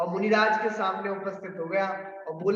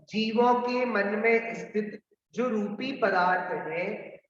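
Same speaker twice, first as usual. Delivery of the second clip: ordinary talk, nothing marked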